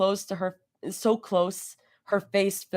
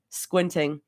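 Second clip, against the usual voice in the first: usual speaking voice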